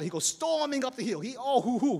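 ordinary delivery

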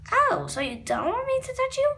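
ordinary delivery

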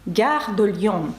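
slow French "Gare de Lyon"; French pronunciation. Shown as a demonstration. Teacher showing learners a Paris station name, French pronunciation. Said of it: "Ліонський вокзал".

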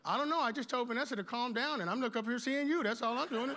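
high-pitched, hurried mock excuse; flustered self-defence. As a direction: plead innocence in a comic retelling. Acts flustered self answering Jesus.

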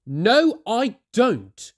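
sulky high refusal; unfriendly no. A narrator's reading. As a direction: brush her off. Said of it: "with an unfriendly look".

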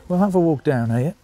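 ordinary delivery

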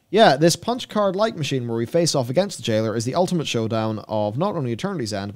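ordinary talk, nothing marked

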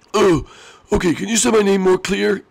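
high, half-sorry little voice; high squeaky character voice. Acted. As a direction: apologise in a squeaky voice.

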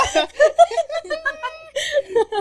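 high-pitched burst of laughter. Real, not acted. Reacts to the joke about being slow, a high laugh. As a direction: crack up in a high squeal.

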